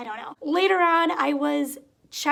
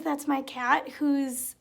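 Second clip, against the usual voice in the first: ordinary delivery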